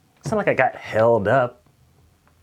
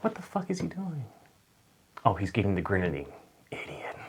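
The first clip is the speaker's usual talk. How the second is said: imitating Jamie; 9 dB quieter than usual. [name] spotting the sneaking waiter, quietly scornful. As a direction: mutter like an annoyed celebrity. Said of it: "Just Jamie's like".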